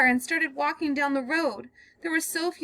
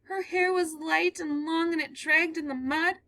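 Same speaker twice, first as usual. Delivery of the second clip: higher, slowing as the horror sinks in; growing dread; 8 semitones higher than usual. Acted. Girl describing the man dragging a body, in dread. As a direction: relive it as you tell it. Girl tells her story.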